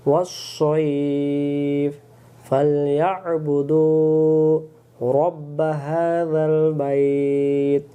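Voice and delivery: very slow, melodic drawn-out recitation; Quran recitation. Shown as a demonstration. Teacher modelling the correct words for students, slow chant. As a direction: stretch মিন and জু'ইউ in a long chant. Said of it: "recites verse as model".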